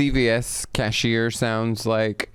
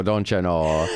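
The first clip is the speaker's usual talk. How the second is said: mock Minnesota accent; 4 semitones lower, slower than usual. Shown as a demonstration. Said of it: "Minnesota, Chicago".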